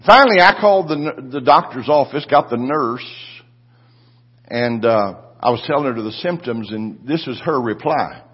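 ordinary delivery

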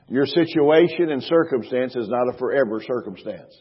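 usual speaking voice

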